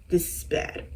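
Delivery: quiet whispered grave verdict; hushed doctor verdict. Retold or imitated. Doctor to her mom, whispering grim news. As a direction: drop to a whisper like delivering bad news. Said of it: "and he goes".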